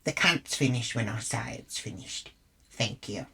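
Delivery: low, quiet retort; curt put-down. Retold or imitated. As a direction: end the argument coolly, dropping the voice. Retold put-down of a stranger.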